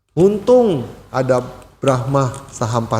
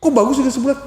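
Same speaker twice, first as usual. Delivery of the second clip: offended wife; 12 semitones higher, faster than usual. Retold or imitated. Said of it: "istrinya marah".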